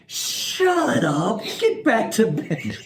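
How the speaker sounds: woken-up wife telling husband to go back to bed, mock grumpy; mean sleepy wife. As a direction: imitate your wife snapping at you to get back to bed, drawn out at the end. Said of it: "and she is mean".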